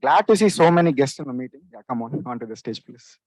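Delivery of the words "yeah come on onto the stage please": ordinary talk, nothing marked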